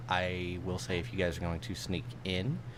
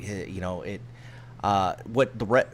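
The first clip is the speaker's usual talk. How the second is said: ordinary talk, nothing marked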